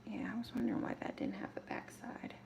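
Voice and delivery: soft whisper to herself; barely voiced aside while working. Real, not acted. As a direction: mutter it under her breath.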